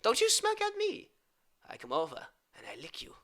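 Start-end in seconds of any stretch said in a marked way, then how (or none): 0.0-1.1 mock scolding. Scolding viewer.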